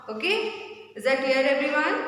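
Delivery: ordinary delivery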